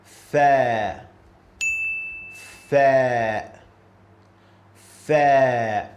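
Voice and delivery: 'Fa' loud and clear, teeth on lip; Arabic letter Fa. Shown as a demonstration. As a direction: model 'Fa' for the class.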